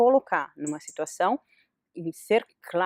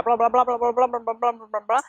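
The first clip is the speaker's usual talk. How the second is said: blah-blah rattled off in one unbroken run; mock endless chatter; 3 semitones higher, 4 dB louder than usual. Mother mimicking someone who talks on unheard, mock endless chatter. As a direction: drone on without a gap, a little louder.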